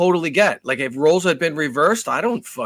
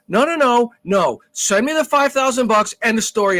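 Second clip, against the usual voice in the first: higher-pitched angry phone protest; retold yelling; 7 semitones higher than usual. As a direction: shut them down, acting out the yelling. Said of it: "we start yelling at each other".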